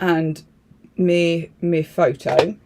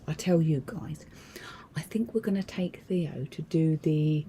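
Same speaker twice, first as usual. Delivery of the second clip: hushed whisper; 6 dB quieter than usual. Real, not acted. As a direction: keep it down like a secret. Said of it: "I'm whispering in case he hears me".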